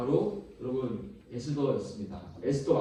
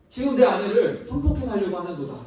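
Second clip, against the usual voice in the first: higher, louder retold royal outcry; king shouting; 6 semitones higher, 6 dB louder than usual. King accuses Haman over his wife, crying out. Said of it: "이렇게 외쳤던 것입니다".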